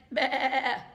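one long wobbly bleat; sheep bleating. Acted. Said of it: "The sheep said".

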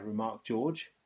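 ordinary delivery, nothing marked

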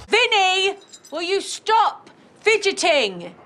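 high, loud telling-off; exasperated scolding. Acted. Scolding Vinny for fidgeting, loud and exasperated.

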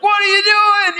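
high, loud call across the field. Retold or imitated. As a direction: shout it from a distance. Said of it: "he's like".

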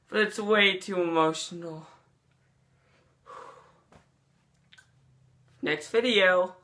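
ordinary talk

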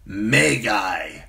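loud, dark grunted accusation. Acted. Blood rider grunts an accusation at the witch, loud. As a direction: spit the word out darkly. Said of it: "grunted Hago".

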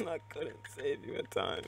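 laughing lament. Real, not acted. Gamer to fallen teammate, laughing lament. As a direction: laugh through a drawn-out apology.

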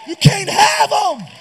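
loud, slow commanding shout; forceful preacher's rebuke. Real, not acted. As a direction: rebuke the devil at full voice.

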